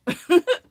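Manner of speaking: high sudden laugh; burst of laughter. Real, not acted. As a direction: laugh out loud, surprised.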